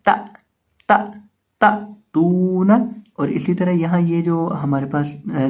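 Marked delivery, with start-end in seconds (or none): 0.1-1.7 ता cut short with a sudden glottal catch; three separate ता, each snapped shut on hamza; jerked hamza stop. Shown as a demonstration. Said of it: "इसको झटका देकर पढ़ेंगे". 2.1-2.9 तू drawn out long before a short ना; recited तू ना with a held long vowel; lengthened vowel. Shown as a demonstration. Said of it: "ता को हम लंबा करेंगे".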